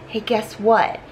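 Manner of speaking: ordinary talk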